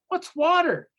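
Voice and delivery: puzzled fish. Acted.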